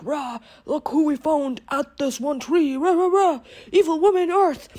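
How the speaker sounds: high, slow grunting gnome voice; growling gnome. Acted. Gnomes brag to the king, grunting slowly and high. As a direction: play a brutish little gnome showing off its catch.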